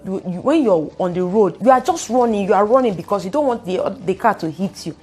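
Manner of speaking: usual speaking voice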